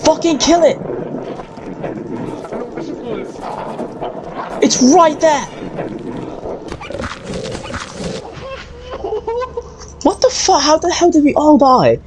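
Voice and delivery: high panicked shouts; frantic yelling. Real, not acted. Dying in the game, shouting at teammates in panic.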